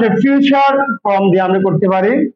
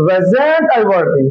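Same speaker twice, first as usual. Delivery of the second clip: slow model question; 4 semitones lower, much slower than usual. Shown as a demonstration. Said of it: "কি হবে?".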